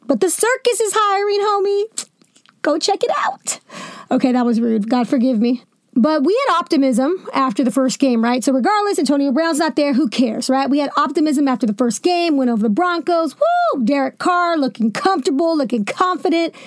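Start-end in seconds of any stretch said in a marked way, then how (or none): 0.1-3.6 mocking taunt. Real, not acted. Mocking taunt at Antonio Brown. 13.3-13.8 high whooping cheer; excited cheer. Retold or imitated. Cheering the first win.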